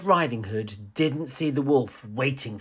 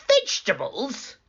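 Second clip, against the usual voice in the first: high, rising disgusted wolf; wolf disgusted by vegetables; wolf crying out; 13 semitones higher than usual. A narrator's reading. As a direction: cry it out like an appalled wolf. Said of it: "cried the wolf".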